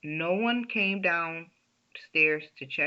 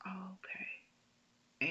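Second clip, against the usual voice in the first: barely voiced, faint reply; weak whisper; 3 semitones higher, 11 dB quieter, much slower than usual. Sick daughter faintly answering her worried dad. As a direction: answer as if too sick to speak.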